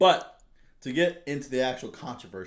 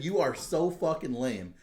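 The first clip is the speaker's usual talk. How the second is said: retold insult; 6 semitones higher, 5 dB louder, slower than usual. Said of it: "I literally said".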